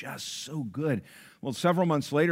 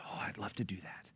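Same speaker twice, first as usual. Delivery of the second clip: softer, breathless whisper; 3 semitones lower, 13 dB quieter than usual. Retold or imitated. Server agreeing quietly to sing for the wife, whispering. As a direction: hush it like a secret. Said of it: "She goes".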